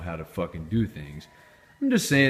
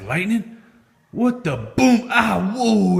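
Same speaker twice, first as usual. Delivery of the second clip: high, louder shocked yelps; startled gas; 13 semitones higher, 7 dB louder, slower than usual. Pretend. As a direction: jump out of your skin at the spark. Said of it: "the gas is like".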